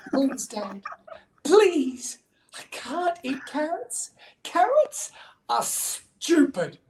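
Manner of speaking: high, slow, whiny begging; hammed-up childish plea; pleading child. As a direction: beg Dad like a desperate little bunny. Betty begs her dad.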